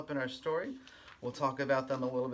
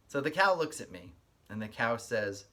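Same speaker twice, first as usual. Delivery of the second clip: lively storybook read-aloud with a sing-song lilt; reading to children; 6 dB louder than usual. Acted. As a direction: read it like story time for little kids. Reads picture book aloud.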